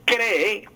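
cree slowly, stressed on the first E: CRÉ-e; acento grave. Shown as a demonstration. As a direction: model cree with weight on CRÉ. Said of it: "con acento grave". (cree con acento en la primera e)

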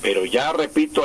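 normal talking voice

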